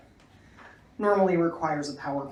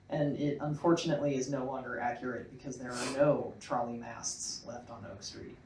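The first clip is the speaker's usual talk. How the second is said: usual speaking voice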